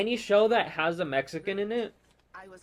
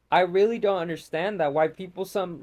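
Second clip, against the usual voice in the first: usual speaking voice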